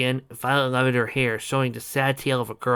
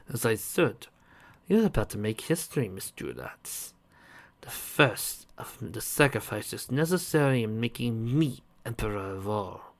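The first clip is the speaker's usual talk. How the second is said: sinister villain voice; 5 dB quieter than usual. Acted. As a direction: proclaim your triumph quietly.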